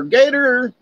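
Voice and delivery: high called-out warning; shouting a warning across the swimming hole; hollered warning. Retold or imitated. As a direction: call it out like spotting a gator. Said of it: "somebody would holler".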